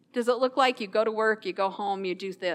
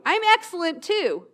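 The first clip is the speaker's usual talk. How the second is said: upbeat reply; 6 semitones higher, 3 dB louder than usual. Retold or imitated. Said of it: "It changed my whole attitude".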